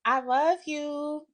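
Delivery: high, slow, syrupy mock "I love you"; mock sweet girlfriend. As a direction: coo it like the other woman. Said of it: "She says".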